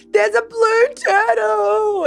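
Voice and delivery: high, loud, slow exclamation; triumphant reveal. Retold or imitated. Showing the lady the picture, high loud triumphant. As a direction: stretch it out in triumph.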